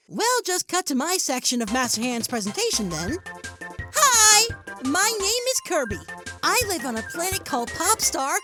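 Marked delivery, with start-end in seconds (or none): 3.9-4.5 high, loud, cheery hello; eager greeting. Acted. Kirby opening a presentation to the heroes, eager greeting. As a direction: burst in with a big hello.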